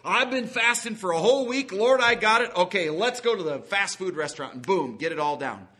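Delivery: loud, high, hammed-up excitement after a fast; mock eager glutton. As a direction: gush like a starving man about fast food. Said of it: "Don't just say".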